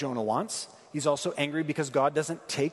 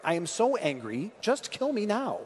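put-on child's tantrum; 6 semitones higher than usual. Preacher imitating his children throwing a tantrum, mock-petulant. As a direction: whine it like a child acting up, slowing at the end. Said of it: "like a child throwing a tantrum".

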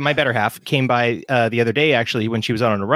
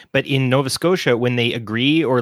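normal talking voice

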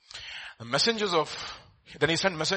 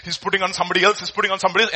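ordinary talk, nothing marked

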